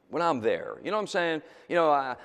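usual speaking voice

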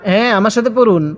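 ordinary delivery

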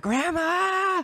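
very high-pitched, drawn-out imitated scream of a child; long, high mock shriek. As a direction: scream it like a kid calling for grandma. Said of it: "he just starts screaming".

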